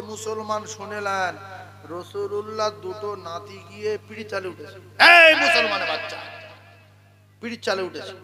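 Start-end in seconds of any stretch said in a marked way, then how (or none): 5.0-6.2 high, loud burst at the crowd; sharp shout. Real, not acted. Preacher rousing listeners mid-story, sudden high shout. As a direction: jolt the listeners awake.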